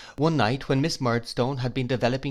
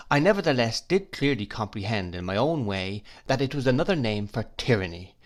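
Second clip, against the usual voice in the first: plain reading voice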